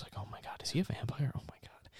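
breathless whisper; whispered gossip. Retold or imitated. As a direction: whisper it like a secret to a friend. Girl gossiping about mysterious boy.

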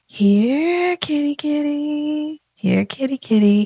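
high, slow coaxing here kitty kitty; cat call. Shown as a demonstration. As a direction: gently lure a cat, drawn out. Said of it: "when you wanna get your cat's attention, you do this".

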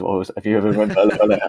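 quick, hammed-up comic policeman voice; mock 'Allo 'Allo policeman. Laughing at his own Spanish to Darren, mock sitcom policeman. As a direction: lightly put on the silly sitcom policeman. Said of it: "I often sounded like the policeman from Hello, Hello".

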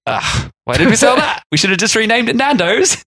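high, whiny mock regret; hammed-up regretful groan; regretful sellers. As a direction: moan it like a seller kicking himself. Sellers regret, voiced in story.

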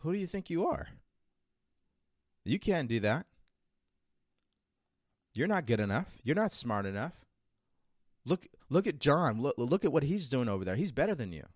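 a nagging inner voice, taunting and high; a lightly voiced mocking inner critic. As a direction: sneer like the doubting self inside your head. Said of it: "it's gonna come screaming back and saying".

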